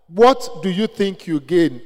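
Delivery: ordinary delivery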